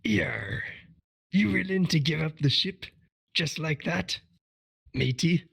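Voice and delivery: a light pirate voice. Pretend. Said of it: "kind of itching to do a pirate voice".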